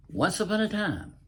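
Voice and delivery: high, sing-song fairy tale opening; grand once-upon-a-time; storybook opening. Acted. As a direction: open it like a bedtime tale, lifted high.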